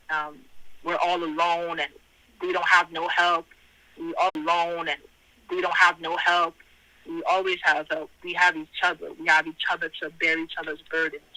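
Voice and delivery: ordinary talk, nothing marked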